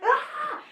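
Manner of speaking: a high, fairly soft imitation of a shriek; mock shriek, very high. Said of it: "and I was like".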